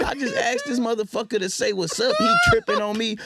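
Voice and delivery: high, loud outraged yell; worked-up yell. Real, not acted. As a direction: shout it, still stunned by the fight. Heated retelling of the fight.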